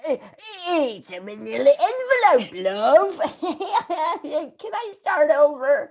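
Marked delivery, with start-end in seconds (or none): 0.7-3.2 low Northern English accent, ending in a laugh; hammed-up Northern England voice. Shown as a demonstration. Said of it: "it's more Northern England".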